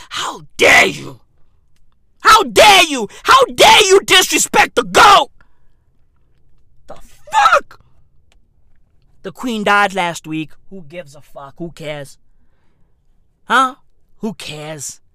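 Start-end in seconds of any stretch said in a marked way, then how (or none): 2.2-5.3 loud, high mock indignation; loud shouted mock fury; indignant shout. Shouted scolding for Messi. 6.9-7.8 high, loud shocked squeal; high shrieked the fuck; shrieked outrage. Acted. 10.7-15.0 dismissive. Acted. Dismissive low mumble.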